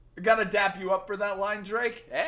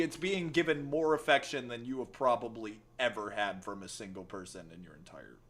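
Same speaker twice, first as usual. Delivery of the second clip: ordinary talk, nothing marked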